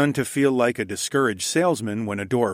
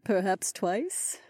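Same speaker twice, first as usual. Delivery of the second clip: soft, slow, high character voice; grim smile; 10 semitones higher, 6 dB quieter, slower than usual. A narrator's reading. Aliona to her husband at the door, grimly smiling. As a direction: offer it tentatively, high and unhurried. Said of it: "offered with a grim smile".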